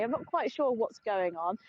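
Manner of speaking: ordinary talk, nothing marked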